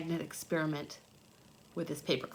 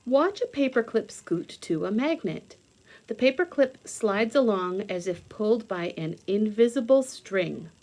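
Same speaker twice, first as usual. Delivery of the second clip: louder, bright read-aloud voice from a science book; 6 dB louder than usual. Acted. Reading a science book aloud to young viewers, louder storybook read.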